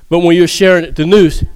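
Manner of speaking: ordinary talk, nothing marked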